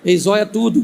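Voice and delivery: normal talking voice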